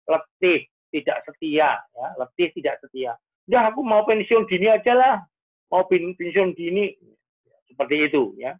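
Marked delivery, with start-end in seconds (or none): 3.5-6.9 higher-pitched mock complaint; acted weary grumble, pitched up; fed-up outburst. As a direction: play a worn-out teacher giving up. Voices a fed-up teacher.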